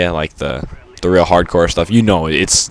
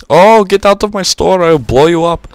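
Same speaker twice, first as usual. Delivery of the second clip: high, loud mock Indian accent; 11 semitones higher, 5 dB louder than usual. Store owner threatening someone in his store, caricatured Indian accent. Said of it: "that was probably an Indian accent".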